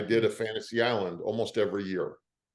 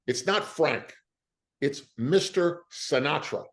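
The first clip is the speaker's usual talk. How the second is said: mock stern correction; 6 semitones higher than usual. Retells Rickles correcting him.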